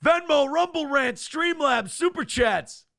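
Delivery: high, punched hollered list; mock catcall. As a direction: holler each name like a catcall. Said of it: "Aaron catcalling goes like this".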